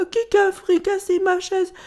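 high-pitched cub whine; whimpering little bear. A narrator's reading. Ch'ti Ours finds his chair broken, whining. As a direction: wail it up high like a small bear. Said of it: "pleurchigna Ch'ti Ours".

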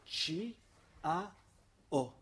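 "C", "A", "O" named letter by letter; teacher spelling "C A O" for pupils. Shown as a demonstration. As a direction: spell "C A O" slowly, one letter at a time.

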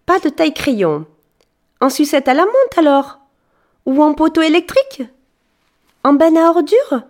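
sweet and chatty, eager to help; fairy thanking the boy, bubbly; cheerful fairy. Acted. Fairy rattles off options.

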